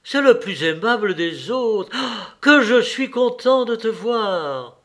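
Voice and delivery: high, loud, joyful greeting; delighted exclamation. A narrator's reading. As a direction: cry out with delight at seeing a friend. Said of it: "s'écria-t-il en reconnaissant Stepan Arkadievitch".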